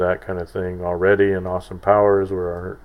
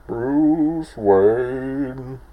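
higher, louder, masked Riddler voice on "Bruce Wayne"; 6 semitones higher, 5 dB louder than usual. Retold or imitated. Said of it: "I really liked him when he was ta-, saying Bruce Wayne".

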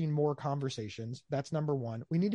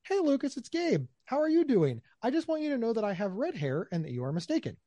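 bright, higher, friendly and courteous; polite correction; 6 semitones higher than usual. Shown as a demonstration. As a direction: sweetly set the record straight. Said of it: "I can write".

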